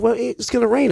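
squeaky high mom imitation. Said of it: "She said".

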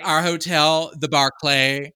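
name drop the hotel proudly; mock haughty name drop. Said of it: "She's like".

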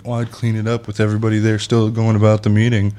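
usual speaking voice

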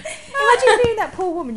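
talking through a laugh; words bubbling with laughter. Real, not acted. Laughing while telling the story.

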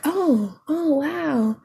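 put-on high, impressed oh wow, accent held back; lightly voiced date. Date looking at pole photo, mildly impressed high wow. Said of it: "I'm trying not to do an accent".

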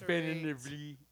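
numb mouth slurring "Definitively"; mumbled through a numb mouth; numb from the dentist. Real, not acted. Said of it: "my mouth don't work good".

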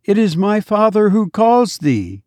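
put-on high, sweet little child calling his mother; a small dead child greeting his mother with joy; child's voice. A narrator's reading. Dead child to his weeping mother, high sweet child voice.

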